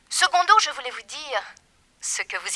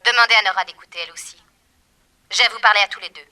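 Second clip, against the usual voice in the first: menacing loud call; 9 dB louder, faster than usual. Acted. Villainess threatens over telephone.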